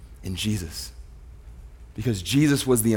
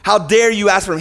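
indignant rebuke; 6 semitones higher, 4 dB louder than usual. Retold or imitated. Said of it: "he doesn't look at us when we ask him like".

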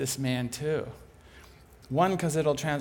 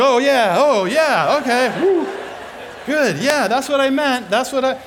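much higher and much louder, flustered, laughing; flustered excitement; 9 semitones higher, 10 dB louder than usual. Retold or imitated. Speaker pretending he knew all along, loud and flustered. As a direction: cover the surprise with loud glee. Speaker covering his surprise.

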